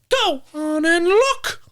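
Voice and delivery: very high, slow, squeaky spirit voice; Jeff's voice. Acted. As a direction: squeak it out like a whiny little ghost. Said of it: "he announced".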